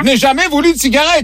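a lightly voiced aggrieved patient; mock patient voice. Prankster playing the patient to the shopkeeper, mild protest.

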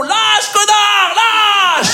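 high, drawn-out yell; cri de panique. Acted. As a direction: scream it at someone who won't let go. Panique au tire-fesse, la station hurle. (cri aigu et affolé)

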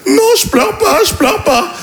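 high whiny denial through tears; crying friend. Retold or imitated. Drunk friend Julien insists he's not crying, high tearful whine. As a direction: whimper the denial like a sobbing drunk.

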